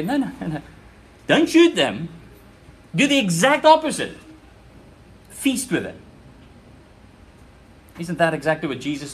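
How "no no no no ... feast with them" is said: rising to a loud, high cry of no, no, don't shoot; emphatic command. Acted. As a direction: Elisha stopping the king, building to a shout. Elisha forbids the king to shoot.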